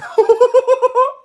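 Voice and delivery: high-pitched helpless cackle; erupting, uncontrollable laugh; real laughing fit. Real, not acted. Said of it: "I'm crying".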